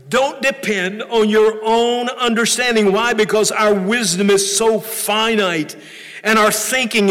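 fervent preacher voice; preaching. Acted. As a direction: press the point home. Preaching on the verse.

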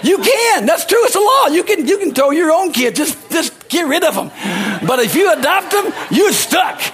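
high, loud, fervent preaching; preaching at full force. Acted. Pastor to congregation about adoption, preaching at full force. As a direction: shout the good news, all fired up.

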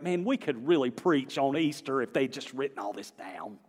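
ordinary talk, nothing marked

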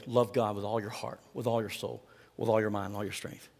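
preacher quietly asking the church to weigh it; quiet, reflective recitation. Acted. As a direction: let the list sink quietly. Quiet recited commandment list.

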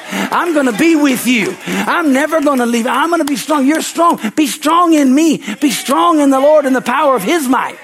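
rising, loud, fervent preaching; preaching at full force. Acted. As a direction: build the voice up to a shout of faith.

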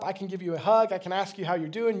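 usual speaking voice

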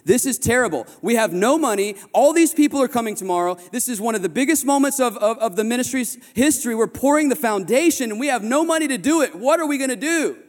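hammed-up disaster alarm; retold panic. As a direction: act out the night-before panic to a friend. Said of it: "I said".

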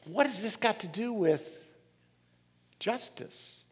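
mock skeptic, softly; skeptical listener. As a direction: ask it like an unconvinced listener. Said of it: "Now, you may say".

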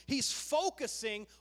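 ordinary talk

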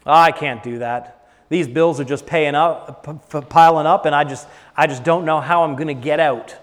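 lightly acted worrier, low and stumbling; defeated complaining. Preacher mimicking people cursing themselves over bills, defeated complaint. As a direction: imitate someone overwhelmed, voice sunk low. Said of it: "heard people talk like this".

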